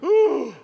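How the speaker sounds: sharp loud gasp of alarm; fearful gasp. Pretend. Husband gasps hearing wife plot murder, alarmed. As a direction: suck in air in shock. Reacting to wife's murder plans.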